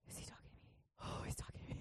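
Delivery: barely audible whispered realisation; hushed, imitated inner voice; awed whisper. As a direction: whisper it to yourself in wonder. Awed inner voice, feeling God.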